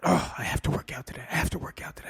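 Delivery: hurried, grudging groan; grumbling reluctance. Acted. Self-talk about having to exercise, grumbling reluctance. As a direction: rattle it off like a dreaded chore. Contrasting have-to with get-to.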